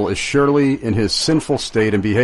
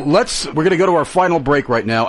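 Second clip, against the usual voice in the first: ordinary talk, nothing marked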